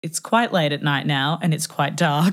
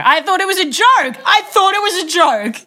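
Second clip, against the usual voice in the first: high, louder exasperated cry; exasperated protest; 11 semitones higher, 4 dB louder than usual. Real, not acted. Storyteller defends herself to shocked friend, exasperated high protest.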